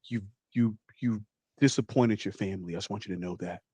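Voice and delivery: low, soft, halting dad voice; disappointed father. Acted. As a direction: play a hurt dad, quiet and low.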